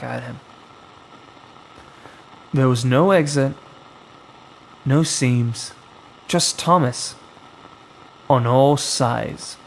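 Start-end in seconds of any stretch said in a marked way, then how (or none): none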